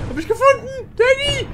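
high loud cry of alarm; excited shout. Real, not acted. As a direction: call out in alarm. Found while hiding, calling.

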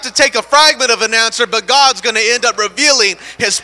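loud, driving, rhythmic preaching; full-force preaching. Acted. Pastor urging the congregation to keep praying, preaching hard. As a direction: hammer the hunger point home.

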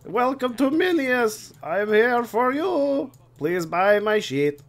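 high, slow sing-song shopkeeper; overly eager little merchant; cheery merchant voice. Retold or imitated. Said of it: "Why does he sound so manly?".

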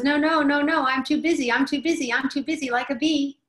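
hammed-up harried adult, buzzing busy. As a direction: brush the child off in a fluster. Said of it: "the inner adult ... says".

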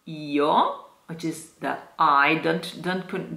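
ordinary delivery

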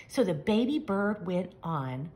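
ordinary delivery

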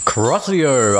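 high incantation cry; shouted spell. A narrator's reading. Narrator casts a curse.

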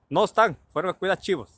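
a hint of a hollering auntie; aunt calling back. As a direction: imitate an aunt shouting a reply.

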